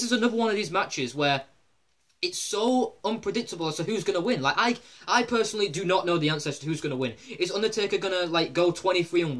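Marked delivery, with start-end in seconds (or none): none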